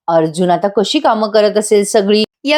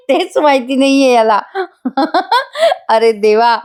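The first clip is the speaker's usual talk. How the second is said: talking through real laughter; amused, words breaking into laughs; laughing; 4 semitones higher than usual. Real, not acted. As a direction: giggle while telling it. Narrator laughing at Arjun.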